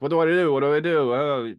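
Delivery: hammed-up helpless panic; mock freak-out. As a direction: imitate someone frantically freaking out. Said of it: "He's freaking out".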